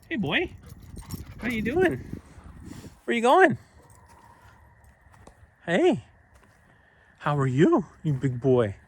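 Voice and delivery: high sing-song dog voice; talking to a dog. Real, not acted. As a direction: coax the dog, pitch way up.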